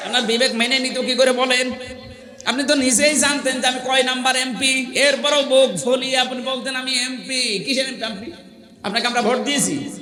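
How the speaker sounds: high, insistent sermon voice; forceful preaching. Real, not acted. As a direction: drive each point home to the crowd. (জোরালো ওয়াজের উঁচু গলা)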